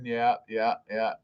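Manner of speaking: normal talking voice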